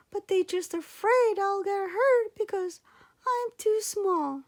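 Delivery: put-on high, loud eager little boy voice; a tiny boy pleading to help, pitched high and loud; little boy Sandangao. Child hero voiced in story.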